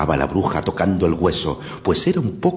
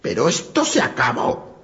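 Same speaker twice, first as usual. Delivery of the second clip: high, cackling witch fuming; raging witch. Acted. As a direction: rant like a furious witch. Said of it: "rabiaba la bruja".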